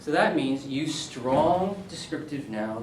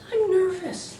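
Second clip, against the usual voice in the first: "I'm nervous" said much higher as the better word; bright example; slower than usual. Shown as a demonstration. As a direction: offer "I'm nervous" high and eager. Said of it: "That's a better word".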